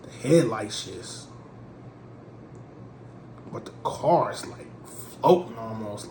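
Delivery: ordinary delivery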